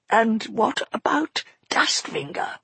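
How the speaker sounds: raspy, breathless whisper; a hoarse, strained whisper. Acted. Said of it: "Eleanor's voice sounded hoarse".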